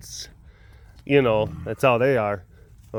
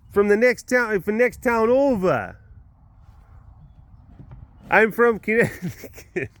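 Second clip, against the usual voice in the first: put-on brash Connecticut accent scolding animals; 8 semitones higher than usual. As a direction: scold the lemurs like a Connecticut cop. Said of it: "Connecticut attitude".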